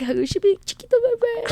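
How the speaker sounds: doting mom. Retold or imitated. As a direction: coo teasingly in a mother's baby voice. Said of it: "She's like".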